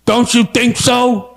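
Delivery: slow, deliberate politician impression; mock-earnest drawn-out delivery; Chuck Schumer. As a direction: draw the words out, peering over the glasses. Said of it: "with his glasses going".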